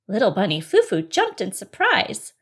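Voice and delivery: ordinary delivery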